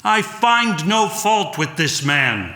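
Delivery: high, slow, ringing declaration. Acted. Pilate declares to the crowd in a slow ringing voice. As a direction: proclaim it so the whole crowd hears. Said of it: "in a ringing voice he said".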